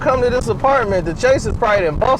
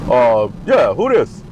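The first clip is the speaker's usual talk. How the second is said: much lower, unhurried voice on the phone; a much lower, relaxed LeBron; LeBron impression; 12 semitones lower, slower than usual. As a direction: answer low and casual.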